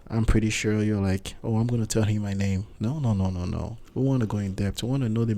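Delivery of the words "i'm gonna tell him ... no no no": normal talking voice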